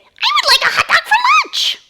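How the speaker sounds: very high, loud squeaky hamster. Shown as a demonstration. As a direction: squeak it out like a tiny toy hamster. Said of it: "in a hamster voice".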